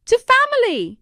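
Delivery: high, loud toast. A narrator's reading. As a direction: raise the glass and shout it. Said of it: "Nadine added".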